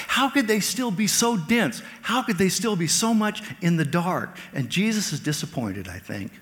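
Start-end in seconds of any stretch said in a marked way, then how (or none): 0.1-4.4 higher and louder, amazed disbelief; raised preaching question; incredulous. Real, not acted. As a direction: marvel at how dense they are.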